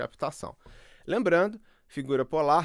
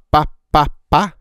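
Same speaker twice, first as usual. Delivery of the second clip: pá pá pá spat right into the mic, much louder with bursting p's; popping plosives; 15 dB louder than usual. Shown as a demonstration. Showing how pá pops when spoken close to the mic. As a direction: pop each pá into the capsule. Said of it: "quando eu falo P e B, ele fica mais estourado".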